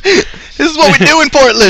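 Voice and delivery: high, hammed-up proud yell; mock local bravado. Friend joins the bit, mock proud local yell. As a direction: boast it in a goofy voice.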